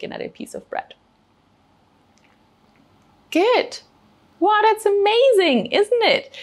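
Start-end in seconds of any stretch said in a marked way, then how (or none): 3.3-6.3 high, excited wonder; excited teacher praise, high and emphatic; amazed delight. Real, not acted. Teacher marvels at children's taste discovery.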